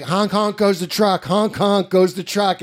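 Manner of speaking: put-on little boy voice. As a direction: chant it like a delighted toddler. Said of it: "acting like a little boy who's seeing a truck".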